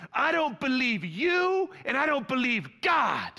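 put-on high, slow, defiant child's shout; little boy defiance. Pastor voicing his small son defying mom, high slow shout. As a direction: play the stubborn little son yelling back. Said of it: "he goes".

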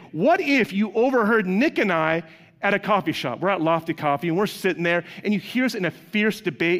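normal talking voice

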